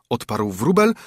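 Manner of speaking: usual speaking voice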